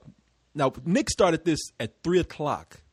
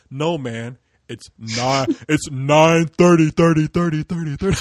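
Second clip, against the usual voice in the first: ominous; 6 dB louder, slower than usual. Retold or imitated. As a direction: drag out thirty like a bad omen. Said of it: "the way he said it, it was so ominous".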